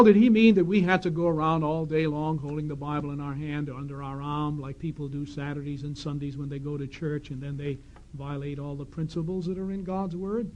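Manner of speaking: normal talking voice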